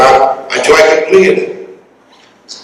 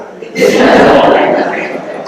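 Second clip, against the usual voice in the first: real laugh. Real, not acted. Joking about his Sun City curfew, laughing. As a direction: laugh lightly at himself.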